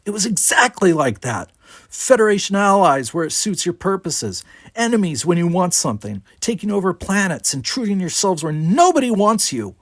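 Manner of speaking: high, heated tirade; relentless. A narrator's reading. Brickar piles accusations on Worf, relentless. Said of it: "The Brickar was relentless".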